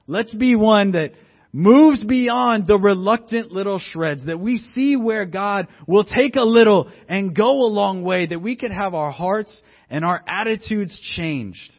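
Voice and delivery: high, loud rallying exhortation; full-force preaching. Real, not acted. Preacher urging his church to move beyond reluctance, rousing preaching. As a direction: build to a sermon climax, drawing out the key words.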